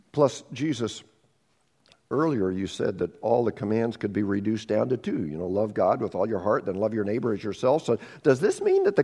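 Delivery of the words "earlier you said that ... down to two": normal talking voice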